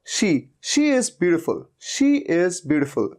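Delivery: slow she is beautiful, she with a full sh; clear sh in she. Shown as a demonstration. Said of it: "इसे बोलना है आपको शी".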